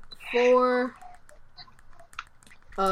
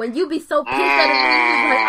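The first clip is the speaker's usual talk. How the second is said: heated rant; 4 dB louder, faster than usual. Real, not acted. Ranting about hating a man.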